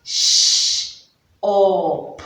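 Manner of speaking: clipped segmented "Shh, up" like a robot. Shown as a demonstration. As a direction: blend sounds like a mock robot. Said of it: "like Mike the robot".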